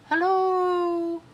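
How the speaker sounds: high distant echoing call; echo, far away. Acted. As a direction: answer like your own voice from far off. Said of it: "It sounded like my voice, only far away".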